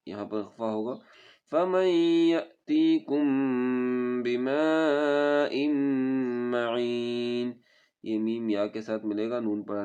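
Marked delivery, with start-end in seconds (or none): 1.5-7.5 melodic chanted tajweed recitation; Quran recitation. Shown as a demonstration. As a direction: recite slowly and musically, stretching vowels.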